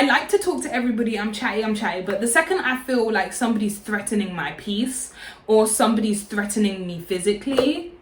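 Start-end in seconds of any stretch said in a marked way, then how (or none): none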